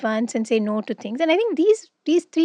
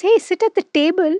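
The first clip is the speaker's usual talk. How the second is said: brighter, more eager quote; excited; 6 semitones higher, faster than usual. Retold or imitated. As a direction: say it like an invitation. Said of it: "when she says".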